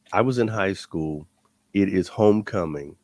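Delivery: normal talking voice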